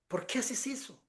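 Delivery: hushed reproach. Retold or imitated. Friend Rosita quietly scolding him in the church, hushed reproach. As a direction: murmur it so nobody else hears.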